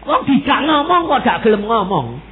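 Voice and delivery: high, loud exasperated protest; mock complaint. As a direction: imitate someone griping that they're ignored. Mimics listener complaining God is silent.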